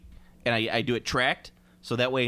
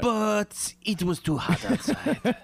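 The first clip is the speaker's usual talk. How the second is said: put-on higher, slow, laughing French accent; mock French postman; 9 semitones higher, much slower than usual. Mocking the European post, a slow French accent. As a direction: ham up a lazy French mailman.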